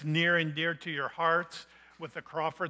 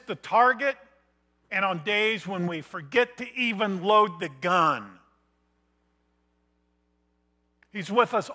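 rising preaching; 3 semitones higher, 8 dB louder than usual. Acted. Pastor stirring the congregation, preaching at full force. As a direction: build it up like a sermon's climax.

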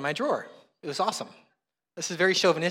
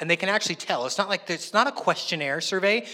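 ordinary delivery

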